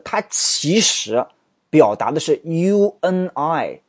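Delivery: ordinary talk, nothing marked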